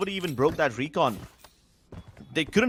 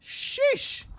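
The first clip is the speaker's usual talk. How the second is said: airy awed sheesh; breathy sheesh. Real, not acted. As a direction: whisper it out impressed. Awed reaction.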